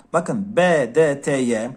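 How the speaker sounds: ordinary talk, nothing marked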